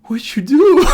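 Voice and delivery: mock panicked shout, way up high; Chris Farley yell. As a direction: yell it like Farley in Tommy Boy. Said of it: "and he's like".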